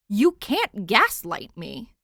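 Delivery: loud, slow, exasperated protest; exasperated outburst. Acted. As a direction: throw up your hands and say it slowly. Exasperated Nightwing.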